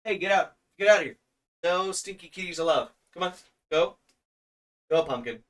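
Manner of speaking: high, sharp shoo; shooing the cat. Real, not acted. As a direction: chase the cat out.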